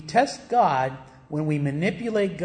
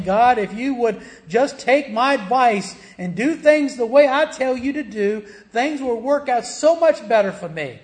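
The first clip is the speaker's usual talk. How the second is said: loud, high mock complaint to God; whiny grumbler; 7 semitones higher, 6 dB louder than usual. Preacher mimics a believer grumbling at God, loud and whiny. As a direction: play the demanding grumbler, pushing hard.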